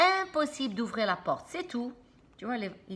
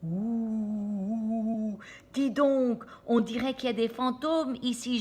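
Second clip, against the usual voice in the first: moaning; 2 semitones higher, 3 dB louder than usual. Acted. Sammy moans in fear about the ghosts. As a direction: moan it out in fear. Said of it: "gémit Sammy".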